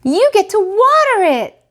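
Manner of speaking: put-on high, loud group shout of kids; shouted together. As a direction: yell it out as a chorus of children. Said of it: "the Hopkins all shouted".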